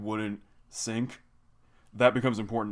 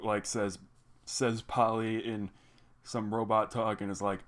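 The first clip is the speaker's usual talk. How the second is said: ordinary talk, nothing marked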